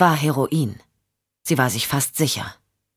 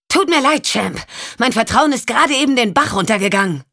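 higher, sarcastic regret; Michelle, cutting; 7 semitones higher than usual. Acted. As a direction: dismiss him with mock sorrow. Michelle rejects Champ coldly.

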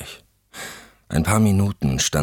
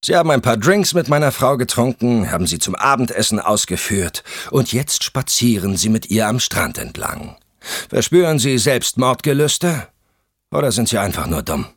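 angry husband; 8 semitones higher, 5 dB louder than usual. Acted. Husband berates wife's companion.

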